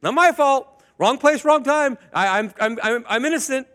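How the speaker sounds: put-on high, loud whiny child protesting; mock child insisting it's not his fault; whiny kid excuse. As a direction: act like a caught kid pleading innocence. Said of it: "Your kids do something".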